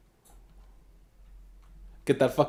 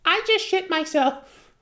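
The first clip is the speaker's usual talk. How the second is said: put-on much higher, slow small-child voice; 14 semitones higher, slower than usual. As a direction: say it like a sheepish little kid. Kid voice in joke.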